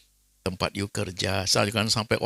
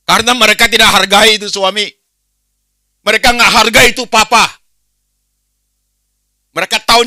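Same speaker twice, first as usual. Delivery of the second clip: much higher, much louder fiery sermon shouting; full-force preaching; 14 semitones higher, 14 dB louder than usual. Acted. Preacher rebuking uncaring families, full-force shouting. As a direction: thunder it at the congregation.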